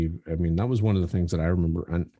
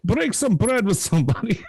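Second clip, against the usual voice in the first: hammy Yiddish-style caricature voice; 12 semitones higher than usual. Retold or imitated.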